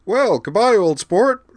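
high, loud jolly toff; cheery old sport. A narrator's reading. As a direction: boom a hearty upper-class farewell. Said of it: "said Garin cheerily".

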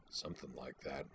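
low, dark, satisfied mutter. Acted. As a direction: mutter it under your breath, pleased. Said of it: "mutter with dark satisfaction".

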